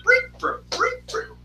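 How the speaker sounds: very high, rapid brr-brr mouth noise; imitated game sound effect. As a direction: chirp out the game's jump sound with your lips. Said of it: "he goes off with".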